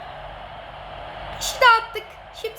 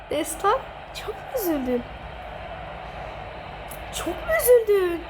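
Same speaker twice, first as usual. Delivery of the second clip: mock sympathy; 2 semitones higher, 5 dB quieter than usual. Real, not acted. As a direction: coo with fake sympathy. Mock pity for friend. (yenilen arkadaşına sahte acıma ile)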